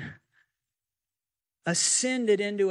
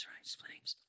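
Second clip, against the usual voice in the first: a hushed aside, barely voiced; hushed whisper; 22 dB quieter than usual. Preacher whispers to the congregation, hushed whisper. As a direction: breathe it in a near-silent whisper.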